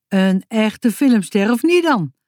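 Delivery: ordinary delivery, nothing marked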